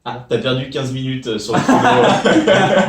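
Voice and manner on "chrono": laughing. Real, not acted. Laughing at lost minutes.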